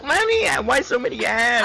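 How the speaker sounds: put-on high whiny child. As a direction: whine it like a kid. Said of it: "they're gonna be like".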